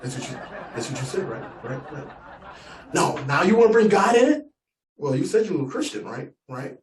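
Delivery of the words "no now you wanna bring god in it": louder, higher mock outrage; indignant retort. Gun owner offended by the nephew, mock outrage. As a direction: snap back offended.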